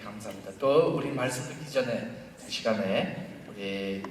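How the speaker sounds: normal talking voice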